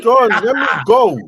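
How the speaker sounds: loud, pushy interruption; loud cut-in. Real, not acted. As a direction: cut in hard to take the floor. Jumping in to claim his turn.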